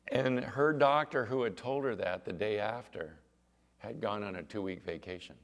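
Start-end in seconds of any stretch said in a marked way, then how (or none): none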